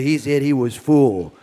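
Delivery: joking Cajun accent. Shown as a demonstration. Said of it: "That's Cajun style".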